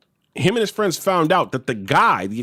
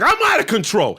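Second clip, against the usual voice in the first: high, affronted comeback; mock disbelief, pitched up; indignant echo; 6 semitones higher than usual. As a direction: imitate a father throwing the accusation back. Father throws guard's words back.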